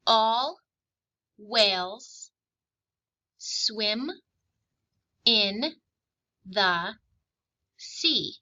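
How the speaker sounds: each word spoken slowly with long gaps; slow, separated dictation reading. Shown as a demonstration. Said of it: "I will read a sentence slowly two times".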